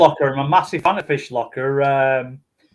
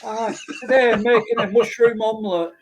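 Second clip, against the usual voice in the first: higher northern cooking-show host voice; Case Cooking impression; 8 semitones higher than usual. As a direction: present the recipe like a cheery northern cook. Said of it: "You remind me of Case Cooking".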